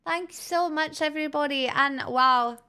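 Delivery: high-pitched put-on Irish accent; hammed-up Irish accent. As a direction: gush thanks in a lilting Irish accent. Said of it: "what an Irish accent".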